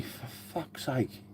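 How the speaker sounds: exasperated whisper under his breath; whispered aside. Real, not acted. Streamer whispering to himself about drunk Nightbot, exasperated. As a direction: breathe it out, fed up.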